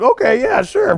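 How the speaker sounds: high, rising astonished agreement; stunned high yes. Retold or imitated. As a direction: squeak out a dazed okay.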